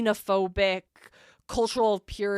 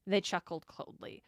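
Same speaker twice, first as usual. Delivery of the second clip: ordinary talk, nothing marked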